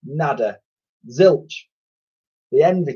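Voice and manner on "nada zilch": ordinary delivery, nothing marked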